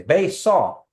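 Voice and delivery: Bae saw with saw falling at the end; Kiowa phrase. Shown as a demonstration. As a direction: model Bae saw clearly for learners. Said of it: "You say".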